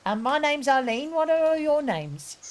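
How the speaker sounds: sweet, nosy lady asking questions; chatty old lady. Acted. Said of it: "she's, she's".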